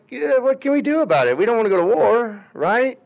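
high, helpless mock countries; lightly voiced excuse-making nations; mock helpless shrug. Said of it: "other countries are just like".

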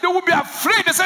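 loud, high excited preaching; full-force preaching. Real, not acted. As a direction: preach it with rising fire.

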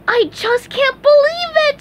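very high, loud cartoon squeal; overjoyed sponge hollering; shouting. A narrator's reading. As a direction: yell it out in disbelief. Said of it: "SpongeBob shouted".

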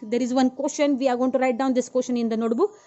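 calling out loud and fast to the class; loud dictation. Real, not acted. Teacher calling the homework out loudly to the class. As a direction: project it so every student hears.

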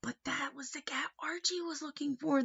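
hushed secret whisper. Real, not acted. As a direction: lean in and whisper it. Teacher shares secret with kids.

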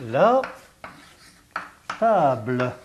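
ordinary delivery

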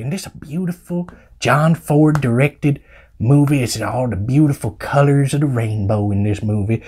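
usual speaking voice

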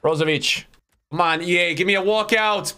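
ordinary delivery

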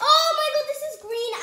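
high loud exclamation; excited exclaim. Real, not acted. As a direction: burst out in surprise. Tasting sour candy.

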